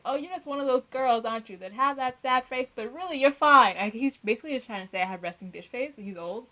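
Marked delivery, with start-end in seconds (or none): 0.0-3.8 higher, louder patronizing teacher; mock teacher. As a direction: talk down like the old teacher. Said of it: "he would be like".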